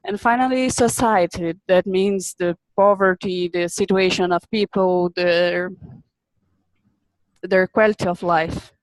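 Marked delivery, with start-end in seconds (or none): none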